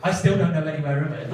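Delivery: ordinary talk, nothing marked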